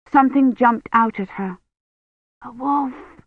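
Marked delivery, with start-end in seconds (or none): 2.4-3.2 put-on high, hushed little-girl voice; soft, awed gasp of words; breathed. A narrator's reading. Agnes hears something jumped out in the tale, breathed. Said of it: "Agnes breathed".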